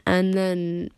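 ordinary talk, nothing marked

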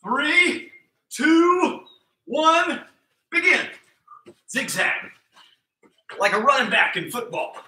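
high, bouncy workout calls; hyped coach calls. Acted. Coach hyping kids through zigzags and push-ups, high and bouncy. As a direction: pump up the kids through the drills. Counts kids into third round.

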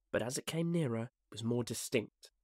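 usual speaking voice

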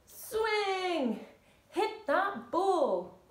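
high, drawn-out sporty call; swinging call. Shown as a demonstration. Coach calling the bat swing, high and drawn out. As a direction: stretch each move out high.

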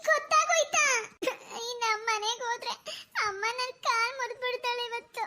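high shriek sinking into a whimper; scared, tearful, high-pitched girl; frightened wail. Acted. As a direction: cry out, then whine in dread. Girl dreads mother's punishment.

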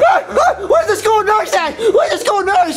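high, frantic calling; panicked kid. Acted. Kid searching for the nurse, frantic and high. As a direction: shout high for help.

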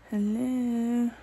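normal talking voice

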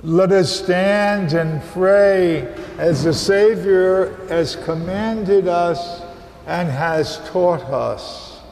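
ordinary delivery

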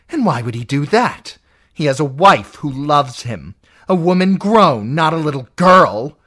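an aunt raging at her niece; furious aunt. Acted. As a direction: rage at the girl. Lysa raging at Sansa.

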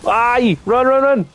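high, panicked run call; panicked yelling. Real, not acted. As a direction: urge everyone to flee, high. Fleeing the monster.

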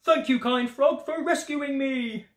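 high-pitched, gleeful mole; delighted storybook mole voice; grateful mole. A narrator's reading. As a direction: thank your rescuer high and drawn out at the end. Mole thanks the frog with glee.

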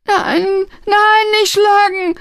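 very high, terrified whine; panicked, squealing young man; stammered plea. A narrator's reading. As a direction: beg not to be hit, drawn out. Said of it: "stammelte der junge Mann".

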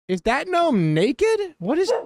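high, slow, stunned squeal of disbelief; shocked disbelief. Real, not acted. Player spotting a naked gnome in her game, shocked. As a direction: gasp it out as if you can't believe your eyes.

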